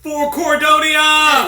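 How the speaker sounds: slow, loud, laughing battle cry. Acted. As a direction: roar it at the charge.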